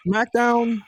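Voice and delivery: high, drawn-out announcer call; show announcement. Acted. Announcing the next show to cohosts, playful announcer call.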